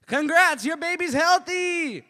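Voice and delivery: high, loud, stretched-out announcement; hammed-up celebratory bellow; shouted congratulations. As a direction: yell it like a prank nurse. Prank nurse announces to parents.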